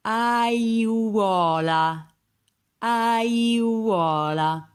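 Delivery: Aiuola drawn out, a-iu-O-la; four-vowel word. Shown as a demonstration. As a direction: stretch every vowel in Aiuola. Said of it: "words with, uh, four vowels".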